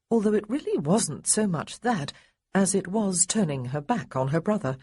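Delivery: ordinary delivery, nothing marked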